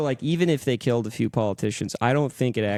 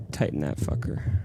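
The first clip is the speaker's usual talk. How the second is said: whispered aside; 8 semitones lower, 3 dB quieter than usual. Real, not acted. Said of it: "whispered aside".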